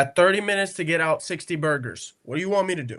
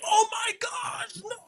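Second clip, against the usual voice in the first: high, slow hammed-up gasp of alarm; mocking the fussing grown-up; mock panicked adult; slower than usual. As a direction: overreact in a shrill mock panic. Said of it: "I'm not gonna go up to him and be like".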